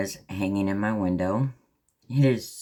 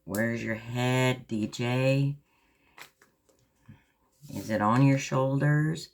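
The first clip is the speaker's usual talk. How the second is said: playful self-scolding; 6 semitones higher than usual. Real, not acted. Teasing herself over a mistake.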